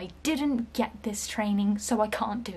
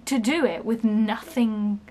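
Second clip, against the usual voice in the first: normal talking voice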